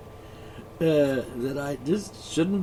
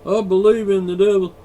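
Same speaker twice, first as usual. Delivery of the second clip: high, loud mock believer; mocking quote; 5 semitones higher, 8 dB louder than usual. Host mimics a devil-believing American, mocking. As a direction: sneer it like a fool. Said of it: "quoting believers".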